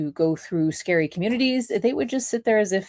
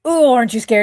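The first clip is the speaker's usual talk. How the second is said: taunting captors; 6 semitones higher, 9 dB louder, faster than usual. Retold or imitated. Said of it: "They're like".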